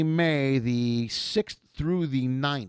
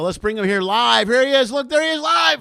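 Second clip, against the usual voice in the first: much higher and louder, shouting; excited hype; 10 semitones higher, 10 dB louder than usual. Real, not acted. Host shouts as the guest appears live. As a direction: bring him on with a shout. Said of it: "host bringing guest live".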